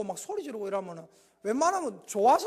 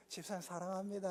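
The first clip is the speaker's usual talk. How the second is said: tender greeting; 5 semitones lower, 12 dB quieter than usual. Shown as a demonstration. Soft gaze greeting demo.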